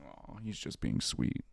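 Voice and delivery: soft, low cooing at a pet. Real, not acted. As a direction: coo over the sweet dog. Cooing at the dog.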